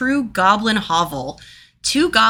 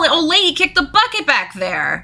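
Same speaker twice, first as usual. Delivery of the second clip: goblin leader; 7 semitones higher than usual. Acted. Goblin leader talking to the party.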